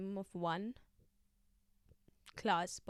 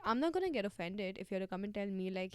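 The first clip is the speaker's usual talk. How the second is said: ordinary talk, nothing marked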